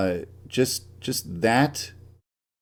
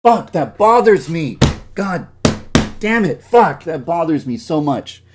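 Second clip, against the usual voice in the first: higher and louder furious venting; furious outburst; 5 semitones higher, 5 dB louder than usual. Real, not acted. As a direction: blow up at the shading bug. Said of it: "That makes me so pissed".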